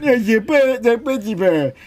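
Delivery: high mangled gibberish syllables; mushy, unintelligible machine chatter; garbled smart speaker. Retold or imitated. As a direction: imitate a voice assistant babbling nonsense. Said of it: "It went".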